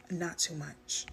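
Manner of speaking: usual speaking voice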